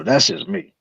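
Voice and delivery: usual speaking voice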